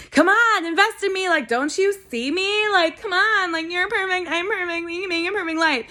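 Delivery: a playful imitation of a desperate plea to a partner; mock pleading. Voicing her plea to a partner.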